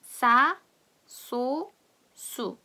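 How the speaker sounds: "싸, 소, 수" drilled one by one with tense ss; double-siot sound. Shown as a demonstration. Teacher leading the class in reading, tense ss in "싸, 소, 수". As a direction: say "싸, 소, 수" separately for repeating. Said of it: "다 같이 한번 읽어볼게요".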